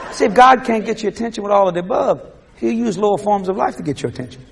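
ordinary talk, nothing marked

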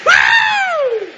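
high, laughing woo; laughing whoop. Real, not acted. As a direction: let out a delighted whoop. Whoop after the punchline.

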